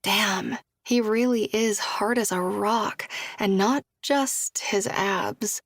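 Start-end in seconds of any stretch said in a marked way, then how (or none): none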